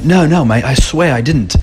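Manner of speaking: high-pitched, badly imitated British accent; shitty British accent. Assistant denies peeing on the floor, bad British accent. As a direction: protest innocence in a mock British accent. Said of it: "it's my shitty accent".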